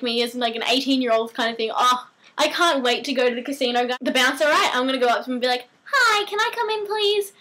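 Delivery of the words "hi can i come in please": put-on high, pleading little kid voice. Eighteen-year-old asking a casino bouncer to get in, little kid voice. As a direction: ask sweetly in a high child's voice. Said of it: "put in my little kid voice".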